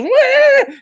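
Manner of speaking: high loud horse cry; whinny like a spooked horse. Acted. The horse rears at the thief, a loud high whinny. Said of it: "and the horse goes".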